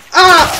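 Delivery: short, very high yelp; startled scream. Real, not acted. Gamer asking who is there, startled scream. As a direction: yelp suddenly, high-pitched.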